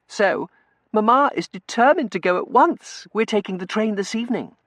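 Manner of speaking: high, loud, breezy announcement; high-pitched, emphatic girl; girlish young lady. A narrator's reading. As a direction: announce the news like a pampered girl.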